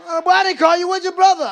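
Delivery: loud, high-pitched questioning; exasperated dad. Acted. Dad questioning the wrong son.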